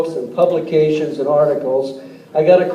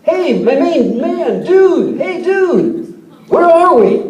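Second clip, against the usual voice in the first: put-on high, stretched-out drunk Marine call into the fog; drunken yell; 11 semitones higher, 3 dB louder, much slower than usual. Lost Marine yelling to a stranger in the fog, high drunken holler. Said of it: "one of the Marines yells out".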